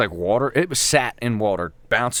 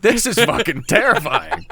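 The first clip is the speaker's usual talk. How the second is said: laughing; 12 semitones higher than usual. Real, not acted. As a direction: laugh while telling the fear. Retold fear, laughing.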